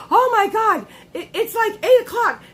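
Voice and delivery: high, loud, laughing alarm; alarmed exclamation. Retold or imitated. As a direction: blurt it out half-laughing in a panic. Panicked self-quote about running late.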